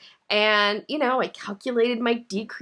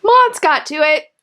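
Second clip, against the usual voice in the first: real upset; 6 semitones higher, 8 dB louder than usual. Real, not acted. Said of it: "I get very emotional about".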